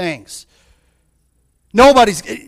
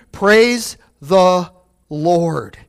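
slow drawn-out praise; 2 semitones lower, much slower than usual. Shown as a demonstration. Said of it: "Do you hear me?".

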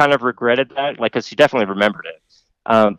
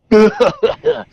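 high imitated maniac laugh; imitating a crazed cackle; mock psychotic cackle; 11 semitones higher than usual. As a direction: cackle like a madwoman, half-joking. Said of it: "psychotic cackle".

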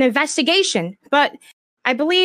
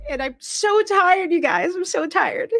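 slow, high, crying admission; worn out and close to sobbing; tearful exhaustion; 5 semitones higher than usual. Real, not acted. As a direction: drag it out wearily through tears. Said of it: "Why am I so emotional".

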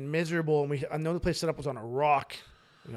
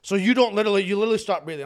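ordinary talk

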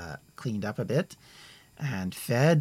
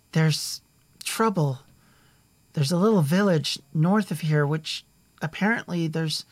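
put-on higher, louder eager young man; a hint of a worried boy; urgent young messenger; 5 semitones higher, 5 dB louder than usual. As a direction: rush the bad news to her. Young messenger delivers news.

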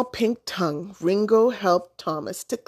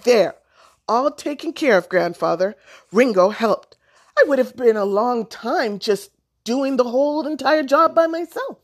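put-on loud, high boyish announcement; raised, self-important report; proud announcing; 5 semitones higher, 6 dB louder than usual. A narrator's reading. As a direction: announce the finished job for all to hear. Boy proudly announcing finished chore.

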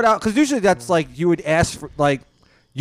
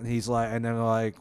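ordinary delivery

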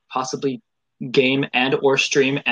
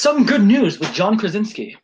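raised, punchy news-show intro; news anchor voice; 6 semitones higher, 5 dB louder than usual. Pretend. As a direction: announce it like a mock news anchor. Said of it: "my best news anchor voice here".

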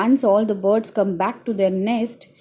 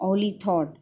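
usual speaking voice

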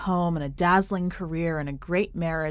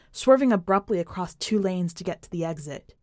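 ordinary delivery

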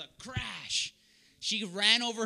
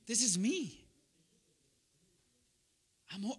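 humbled prayer; 5 dB quieter than usual. Retold or imitated. Retells his humbled prayer to God.

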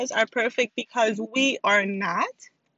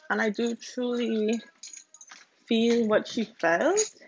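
ordinary talk

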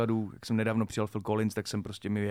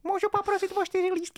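put-on high, small child's voice asking politely; little boy asking. Grown man retelling his boyhood, child's voice. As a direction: speak like a shy little boy at the ticket desk. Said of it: "a já jako malej kluk".

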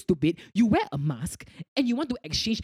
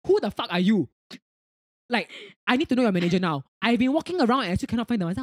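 retold angry caller; 3 semitones higher, 6 dB louder than usual. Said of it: "he was like".

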